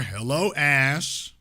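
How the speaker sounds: normal talking voice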